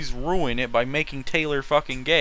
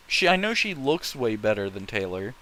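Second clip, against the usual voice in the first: normal talking voice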